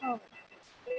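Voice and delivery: usual speaking voice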